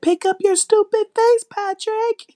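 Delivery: high, loud yell at the screen. Retold or imitated. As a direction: shout it at the quarterback. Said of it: "how many times I said".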